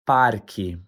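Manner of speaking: "parque" with an audible R, falling tune; standard pronunciation. Shown as a demonstration. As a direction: pronounce the R in "parque", like PAR-kee. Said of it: "The correct pronunciation is".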